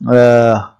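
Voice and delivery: normal talking voice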